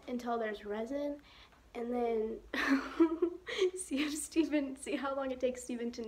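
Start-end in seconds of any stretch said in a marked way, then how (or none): 2.4-3.7 breathy unvoiced snicker; sneaky little laugh; whispered giggle. Real, not acted. Giggle before prank plan.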